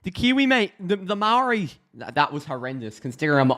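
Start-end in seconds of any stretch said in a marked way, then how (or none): 0.0-1.7 high, excited attempt at a New Zealand accent; bad Kiwi accent. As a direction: hype it up in a badly faked Kiwi accent. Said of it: "I should be able to do a better Kiwi accent".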